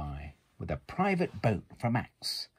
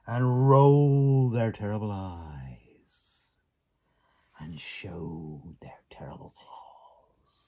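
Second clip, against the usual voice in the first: slow breathy whisper, rolling eyes; slow, creeping whisper to thrill the child; spooky whisper; 5 dB louder, slower than usual. A narrator's reading. Spooky build in picture book.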